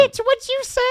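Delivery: very high, loud comic outburst; hammed-up offence in a squeaky high voice; mock outraged squawk. Offended man snapping back at another man, absurdly high and loud. Exaggerates the offended man's reply.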